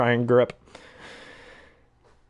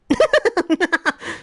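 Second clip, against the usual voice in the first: very high cartoon cackle; rapid squeaky giggle; minion laugh. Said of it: "hate the minions just 'cause of their laugh".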